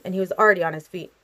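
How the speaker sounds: plain reading voice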